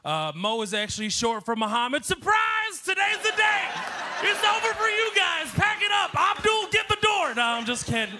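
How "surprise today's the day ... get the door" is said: high, loud shouted commands; barking mock orders at full voice; mock surprise announcement. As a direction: shout it like a sudden joke takeover. Mock takeover after saying his name.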